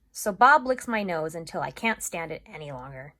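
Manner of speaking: ordinary delivery, nothing marked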